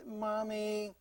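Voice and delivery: drawn-out mock whine; whining child. Child asking mom for a drink, whining. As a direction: imitate a child whining for something. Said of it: "but the way they ask for it".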